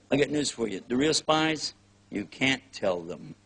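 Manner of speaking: ordinary talk, nothing marked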